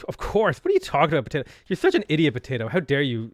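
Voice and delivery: hammed-up outraged comeback; mock indignation. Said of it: "just been like".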